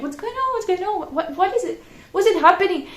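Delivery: high, flustered repeated asking; bewildered questions. Retold or imitated. Vlogger confused by a singing lady on the bus, high flustered questions. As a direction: fire off confused questions at friends. Disoriented, friends laughing.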